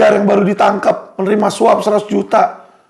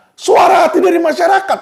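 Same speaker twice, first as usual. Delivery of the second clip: frustrated shout; 8 semitones higher than usual. Real, not acted. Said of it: "kefrustasian saya".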